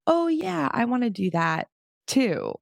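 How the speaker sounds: high, happy discovery; light up like you just got inspired; delighted passerby. Retold or imitated. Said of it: "make somebody go".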